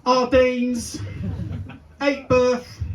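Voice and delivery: put-on high, loud call to a child. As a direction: holler the name like a parent. Mimics calling kids by caravan names.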